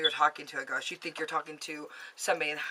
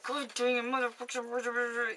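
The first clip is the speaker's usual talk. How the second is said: imitated muttered swearing, higher and slurred; mock grumbling through a hurt toe; mumbled cursing; 6 semitones higher, 4 dB quieter, much slower than usual. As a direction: curse under your breath in gibberish. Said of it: "he's, like, mumbling under his breath".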